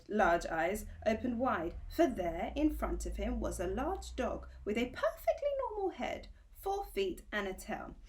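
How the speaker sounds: ordinary delivery, nothing marked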